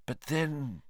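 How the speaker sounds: soft puzzled mutter. Acted. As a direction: murmur it to yourself. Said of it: "Lupin muttered".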